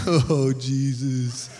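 low, slow groaned prayer; mock dread. Husband bracing for his wife's yell, mock dread. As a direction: drag it out in comic dread.

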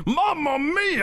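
high, slow "Mamma mia" in a put-on Italian voice; mock Italian exclaim. Hosts joking about a spreading crowd, hammed-up Italian cry.

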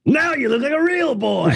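high-pitched, drawn-out mock family chorus; family catchphrase. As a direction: crow it high and slow, stretching "real". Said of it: "would say exactly the same thing".

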